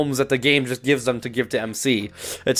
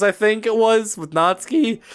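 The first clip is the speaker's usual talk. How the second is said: plain reading voice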